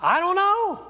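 high-pitched, quick acted 'I don't know'; acted shrug. Pastor voicing a rogue emoji reply, high-pitched shrug. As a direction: toss it up high like a clueless shrug. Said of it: "this is what the emoji was. And it's like".

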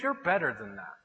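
low, soft man-to-man reproof; quiet admonishment. Retold or imitated. Church friends correcting a guy, quiet admonishment.

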